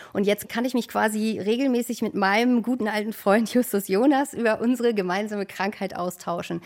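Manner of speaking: ordinary talk, nothing marked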